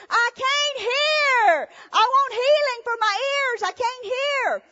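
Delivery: high, loud, slow drawn-out shouting of the hard of hearing; deaf woman hollering. Retold or imitated. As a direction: imitate her bellowing out each plea. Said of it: "I think she was deaf".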